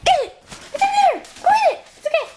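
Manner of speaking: very high excited squeaking; excited squeal. Real, not acted. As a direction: squeal at the dog to get it. Frantic urging in play.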